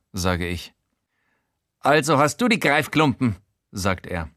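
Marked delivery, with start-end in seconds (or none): 1.8-3.4 higher eager character voice; Showalter's voice. Acted. Showalter asking the narrator for help, higher eager voice.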